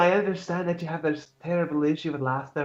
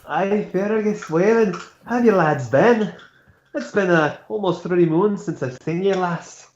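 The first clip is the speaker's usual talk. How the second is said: put-on Scottish accent; 4 semitones higher than usual. Knight hailing his old training friends in Scottish accent. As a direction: greet old comrades in a Scottish lilt. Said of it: "Can't handle your fucking accents".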